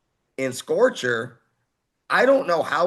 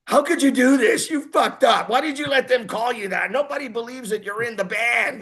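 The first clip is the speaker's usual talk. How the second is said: upset sister; 10 semitones higher than usual. Retold or imitated. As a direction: scold your brother, pitched way up. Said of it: "She goes".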